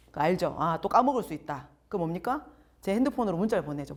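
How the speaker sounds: ordinary delivery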